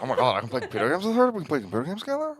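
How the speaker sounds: fast, rising, thrilled; giddy excitement. Acted. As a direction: gush like a smitten nerd.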